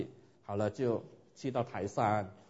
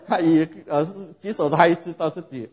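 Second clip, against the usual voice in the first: laughing; 4 semitones higher, 8 dB louder than usual. Real, not acted. Still laughing.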